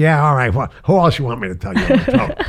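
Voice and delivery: a touch of tough street mom brushing off the caller; mother's street voice. Retold or imitated. Mother answering the phone to a star, mock brusque. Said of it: "a street lady".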